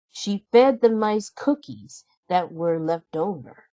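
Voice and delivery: ordinary delivery, nothing marked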